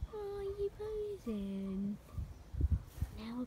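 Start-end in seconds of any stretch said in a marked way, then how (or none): 0.1-2.0 high, very slow teasing coo; baby talk to pet. Acted. Owner teases her posing pet in slow high baby talk. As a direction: stretch it out high like cooing at a puppy.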